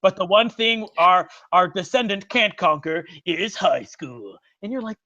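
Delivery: normal talking voice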